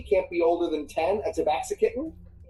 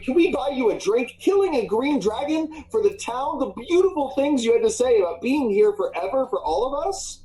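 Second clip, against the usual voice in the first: eager fans; 8 semitones higher than usual. Acted. Fans gushing over hero.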